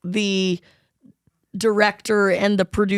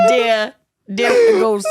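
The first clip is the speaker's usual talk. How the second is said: put-on New York accent; 3 semitones higher, 3 dB louder, slower than usual. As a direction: drawl "dear ghost" in a hammed New York accent. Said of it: "heavy New York accent".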